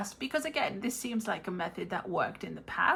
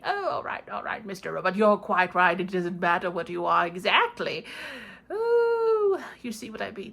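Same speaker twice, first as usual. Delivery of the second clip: ordinary talk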